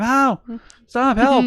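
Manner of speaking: high, laughing mock plea for help; faked victim cry. Husband imitating his wife faking abuse in public, high laughing cries. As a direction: ham up a fake damsel in distress. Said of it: "pretending that I abuse her in public, going".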